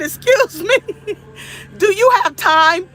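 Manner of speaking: high-pitched question broken up by laughter; giggly, high retold question; laughing through it. Real, not acted. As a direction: retell your own question while cracking up. Laughing while retelling her own question.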